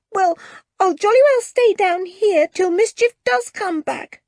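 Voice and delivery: put-on a boy trying to sound brave and not quite managing; shaky voice. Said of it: "in a rather shaky voice".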